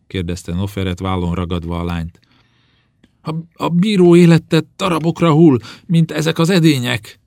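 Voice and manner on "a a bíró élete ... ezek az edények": loud, high, stammering alarm; panicked outcry. Acted. Servant girl to her mistress about the curse, panicked. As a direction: cry out the omen in high-pitched fear.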